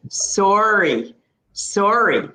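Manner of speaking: "Sorry" with a rounded o, like the word sore; Canadian sorry. Shown as a demonstration. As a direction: say "Sorry" the Ontario way, "sor" as in sore. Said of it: "So how I would say it".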